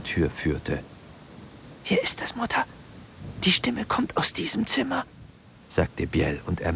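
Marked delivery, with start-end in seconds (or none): 1.8-5.1 frightened whisper. A narrator's reading. Frightened boy at ghost's door.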